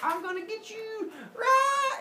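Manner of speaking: high yelling charge; battle cry. Retold or imitated. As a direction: roar it as you leap. Cyclops leaping attack.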